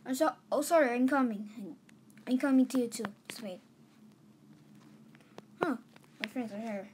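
normal talking voice

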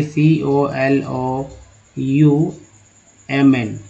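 letters of column spelled very slowly; slow spelling drill. Shown as a demonstration. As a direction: drag out each letter for the class. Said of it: "याद रखिए".

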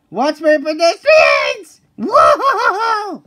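loud, slow alarmed yell; panicked shout. Acted. Shouting while crashing.

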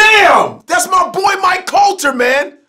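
shouting in dismay; a loud cry of real disbelief; loud outburst. Real, not acted. Reacting to show cancellation.

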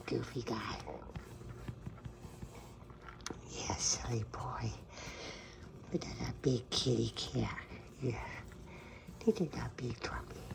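soft whispered pet names. Real, not acted. Owner whispering to her cat Trumpy, whisper.

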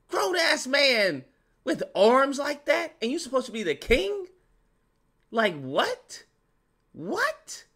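high-pitched outraged disbelief; incredulous rant. Real, not acted. Ranting at actor's lack of muscles.

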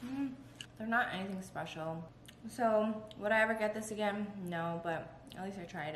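eating croissant between words; talking while chewing. Real, not acted. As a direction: mouth partly full of pastry. Seen 0: Holds bitten croissant, jaw working between words, bites again at 5.1.

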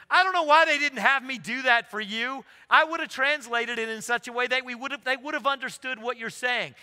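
loud, high-pitched imitated protest; amused exclaiming. Seminary president comes laughing to the preacher, loud imitated exclaiming. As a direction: exclaim it loudly, pitched well up.

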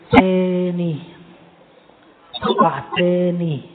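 slow, strange-voiced tempter; taunting devil. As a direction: drag out the taunt like a sneering devil. Devil voice taunting repeated fall.

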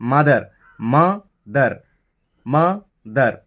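"মা ... দার" as two separate beats; "মা দার" split by a deliberate pause; broken into syllables. Shown as a demonstration. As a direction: say "মা", stop fully, then "দার". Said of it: "ফার বলে থেমে যাচ্ছি".

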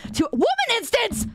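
sudden high-pitched exclamation; playful high yelp; comic outburst. Pretend. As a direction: blurt it out high. Blurts joke mid-sentence.

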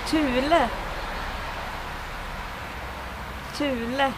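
Thule as "TU-le", Swedish u. Shown as a demonstration. Teacher showing bushcrafters the brand Thule, Swedish pronunciation. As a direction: model Thule in Swedish, word on its own. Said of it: "words that I should, uh, pronounce".

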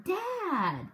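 high, startled outcry; shocked cry. A narrator's reading. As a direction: gasp it out in alarm. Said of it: "I cried".

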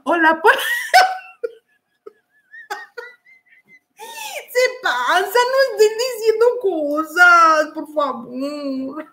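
high, squeaky-laugh plea; overcome by laughter. Real, not acted. Host begging chat to stop saying things, overcome by laughter. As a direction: crack up mid-word, then plead slowly. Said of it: "me gana la risa".

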